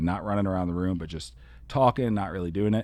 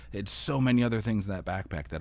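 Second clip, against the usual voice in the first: usual speaking voice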